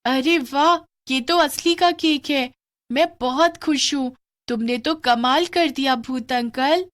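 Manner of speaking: thrilled at the magic cake; delighted girl. Acted. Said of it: "भूत जादू से वहां एक केक ले आता है".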